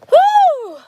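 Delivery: high excited woo; sudden loud whoop. Real, not acted. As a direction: let out a loud happy whoop.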